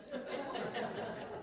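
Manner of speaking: short real laugh at himself. Real, not acted. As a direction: chuckle at the joke on him. Laughs at own punchline.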